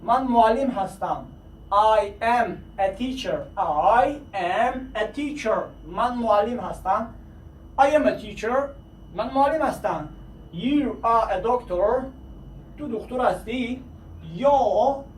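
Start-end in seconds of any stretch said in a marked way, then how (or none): 1.7-5.6 very slow, each word apart; very slow repeat for learners; very slowly. Shown as a demonstration. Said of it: "آهسته با آهسته". 10.5-12.0 slow, careful You are a doctor; slow classroom model; slowly. Shown as a demonstration. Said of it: "آهسته با آهسته".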